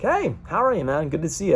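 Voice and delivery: cheery high hello; fake-cheerful welcome at the door; bright mock greeting. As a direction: greet him like nothing happened.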